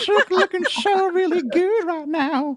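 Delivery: put-on very high, loud whistling old-man voice; creepy old man in thin falsetto; Herbert.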